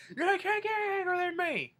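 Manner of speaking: high, strained indignant yell; mock angry boast. Grandpa challenged by the angriest Whopper, mock-furious yell. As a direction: imitate an outraged boast at a high pitch. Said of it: "And he was like".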